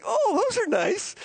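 politely pleased. Retold or imitated. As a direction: imitate polite thanks for an unwanted gift. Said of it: "she's really polite".

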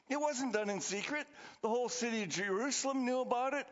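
ordinary delivery

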